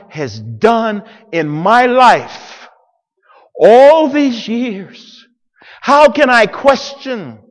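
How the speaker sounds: loud, high, repeated "How can I" hammered; impassioned preaching. Acted. Joseph pleading his whole story to his brothers, loud preaching. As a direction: build each question like a sermon climax.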